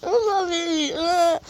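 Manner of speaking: hammed-up cry at her own drawing; mock whimper. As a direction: whimper like a scared little kid. Reacts to an embarrassing drawing.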